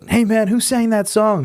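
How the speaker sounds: lightly voiced tourist, high and slow. Punter asking the singer who sang it, high and slow. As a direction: ham up a clueless punter's question. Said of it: "you always get it".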